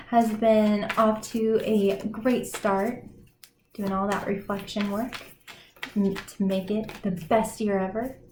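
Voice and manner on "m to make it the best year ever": usual speaking voice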